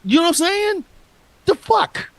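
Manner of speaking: shrill high-pitched squeal of disbelief; screechy comic outrage. Real, not acted. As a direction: shriek it in mock outrage. Outraged squeal at the heels joke.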